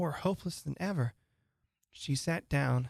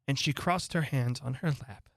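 ordinary delivery

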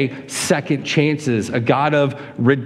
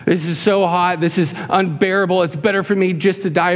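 higher-pitched mock whine; whining; 5 semitones higher than usual. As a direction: complain like a sufferer who can't bear it. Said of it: "He's whining about it".

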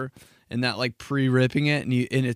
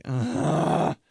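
annoyed groan; 4 semitones higher, 10 dB louder than usual. Retold or imitated. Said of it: "I'm like".

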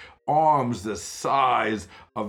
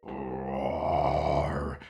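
low growl; lion roar; 6 semitones lower than usual. Acted. Lion nearer.

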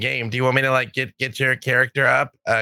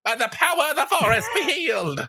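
high proclaiming forest blessing; grand healer invocation; 11 semitones higher than usual. Acted. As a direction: call on the forest like a priest. Healer invokes forest power.

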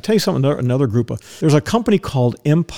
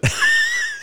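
laugh. Real, not acted. Laughs at his own story.

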